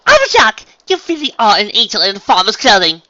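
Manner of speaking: high, loud overjoyed scream. Acted. Unicorn tackles her friend in a hug, screaming out. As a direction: gush at full volume. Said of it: "all the while screaming out".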